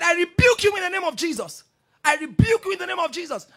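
high, loud commanding rebuke; forceful rebuke. Retold or imitated. Pastor rebuking the witch in church, loud commanding shout. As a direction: cast the spirit out with force.

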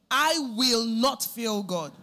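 loud, slow preacher's vow; emphatic declaration. Real, not acted. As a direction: proclaim it forcefully, stretching each word. Preacher's climactic declaration to congregation.

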